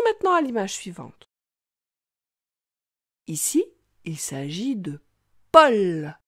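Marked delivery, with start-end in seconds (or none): 5.5-6.2 "Paul" loud with the L held long; drawn-out final L. Shown as a demonstration. Teacher making the child hear the L in Paul, L lengthened. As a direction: hold the L at the end of Paul. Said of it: "Tu entends bien une seule fois le son L".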